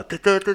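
high, repeated horn blasts; imitated air horn. Teller mimics a protester's horn beside him, honking. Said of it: "עם זמבורה".

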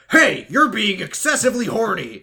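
loud, high mock scolding; imitated coach shouting. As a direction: bellow like an outraged coach. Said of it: "then the coach comes in".

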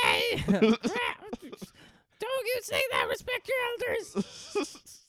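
very high, slow, creaky scolding; mock grandma. Host teased about accents plays offended elder, mock grandma voice. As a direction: scold like a put-on indignant granny. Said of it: "your accents sound like your grandma".

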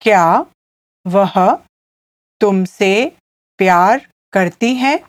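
word by word, long pauses between; slow teaching repeat, each piece set apart; slowly. Shown as a demonstration. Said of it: "I'll say it again slowly".

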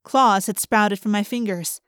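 usual speaking voice

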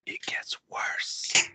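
eerie whisper. A narrator's reading. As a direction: breathe it out slowly. Creepy narration whisper.

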